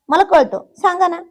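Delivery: pestering, mildly obstinate plea; stubborn insistence. A narrator's reading. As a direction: insist like an obstinate young wife. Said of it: "ती हट्टाने म्हणाली".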